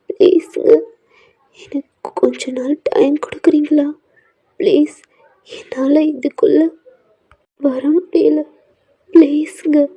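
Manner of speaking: tearful pleading. Acted. Wife begging her husband for time, tearful. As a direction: beg him to wait, close to crying. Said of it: "உங்க கண்ணுல அவ்வளோ tears வருது".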